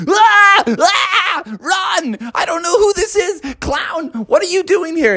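high hammed-up fright; panicked parents. Parents scared by unknown clown, panicked. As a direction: shout high in cartoon alarm.

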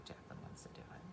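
unvoiced whisper aside; softly whispered confession. Real, not acted. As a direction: whisper it so she can't hear.

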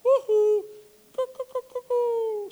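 high, loopy cuckoo noises; sing-song cuckoo sound, mocking madness; mock crazy woohoo. Listener mocking Jesus as crazy, high cuckoo calls. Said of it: "you would think right now".

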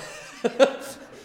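short unvoiced chuckle; real little laugh; breathy laugh. Real, not acted. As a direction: laugh at yourself. Laughs at own remark.

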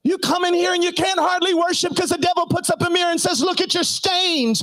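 high, shouted, impassioned preaching; preaching at full force. Acted. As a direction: hammer each line out at the top of your voice. Sustained preaching.

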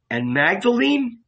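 sudden high alarmed cry; frightened cry. A narrator's reading. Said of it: "Jesus cried, frightened".

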